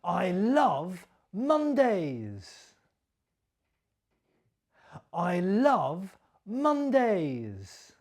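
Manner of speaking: slow, with LOVE stressed and a falling end. Shown as a demonstration. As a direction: lean on "love" and let it fall slowly. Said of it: "Did you copy my stress and my intonation".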